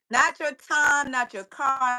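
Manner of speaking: normal talking voice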